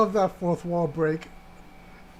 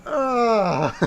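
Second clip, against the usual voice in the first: high, loud cackling laugh that almost cries; helpless wheezing laughter; burst of laughter; 7 semitones higher, 7 dB louder than usual. Real, not acted. Cracks up at quest item name.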